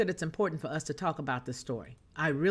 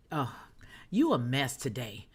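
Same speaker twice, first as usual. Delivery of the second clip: ordinary talk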